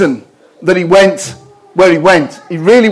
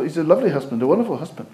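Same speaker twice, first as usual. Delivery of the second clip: tender praise; 2 semitones lower, 11 dB quieter, faster than usual. Retold or imitated. Wife praising husband quietly.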